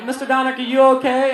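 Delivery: high-pitched imitation of a checking-in principal; mock-worried high voice; concerned principal.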